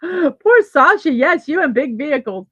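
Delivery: real amused outburst; laughing sympathy. Real, not acted. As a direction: laugh while commiserating. Laughing at a viewer's comment.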